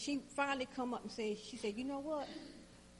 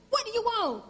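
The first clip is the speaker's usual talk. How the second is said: much higher, loud snapping yell; frazzled mom yelling at her daughter; 12 semitones higher, 13 dB louder than usual. Retold or imitated. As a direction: yell at the kid. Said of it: "sorry for yelling at you".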